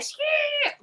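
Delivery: imitated high movie-ghost screech; horror screech. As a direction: screech like the shadow spirits in Ghost. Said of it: "that horrible sound".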